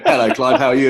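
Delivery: mock Whitey greeting stiffly and awkwardly; formal and awkward. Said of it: "He went".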